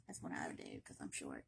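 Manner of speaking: whispered joking confession; hushed self-mocking aside. Real, not acted. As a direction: whisper it like a secret.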